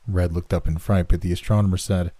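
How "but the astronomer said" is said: ordinary delivery, nothing marked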